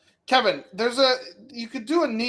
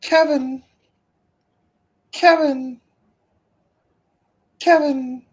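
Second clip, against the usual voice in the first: raised, higher call to the screen; 6 semitones higher, 5 dB louder than usual. Real, not acted. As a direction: call his name again and again. Calling out to the wrestler.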